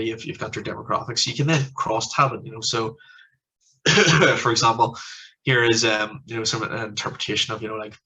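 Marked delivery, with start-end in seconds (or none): 3.8-4.3 a single sharp cough; quick cough mid-sentence. Real, not acted. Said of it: "I'm sorry if I keep coughing".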